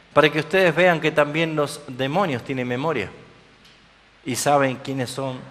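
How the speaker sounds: usual speaking voice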